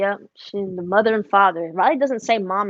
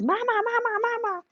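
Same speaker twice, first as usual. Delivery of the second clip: put-on much higher, eager little boy's "mama, mama"; toddler calling; 13 semitones higher, 3 dB quieter than usual. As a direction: call like a small boy racing to grandma. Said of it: "Roman looks up and runs".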